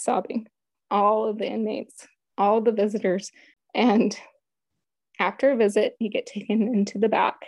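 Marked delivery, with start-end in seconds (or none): none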